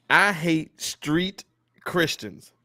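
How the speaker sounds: "I hate street Christians" slowed right down, word by word; emphatic rant line. Real, not acted. Host announcing his pet hate, "I hate street Christians" slow and emphatic. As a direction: lean on each word of "I hate street Christians", pausing before "Christians". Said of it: "This is my rant for today".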